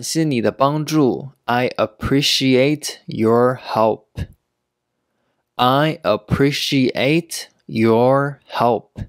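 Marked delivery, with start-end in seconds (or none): 5.6-9.0 slow, each word set apart; slow teaching pace. Shown as a demonstration. Teacher showing learners how to give thanks, slowly. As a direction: pause after each word so learners can follow.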